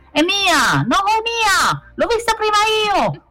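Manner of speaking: very high squealing little voices; squabbling cubs yelling. A narrator's reading. Cubs fight over pieces of vegetable, high-pitched yelling. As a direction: yell like little ones fighting over a treat. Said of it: "urlavano i cuccioli".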